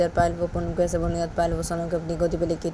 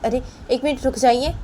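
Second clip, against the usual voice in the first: calling out; 7 semitones higher, 4 dB louder than usual. Acted. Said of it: "इन दोनों की तरफ देख के बोलते कि".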